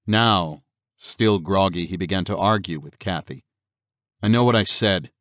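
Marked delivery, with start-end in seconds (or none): none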